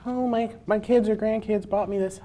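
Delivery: a hint of a confused elderly patron; older patron. Library patron asking the desk for help, lightly voiced old person. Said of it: "and it's like".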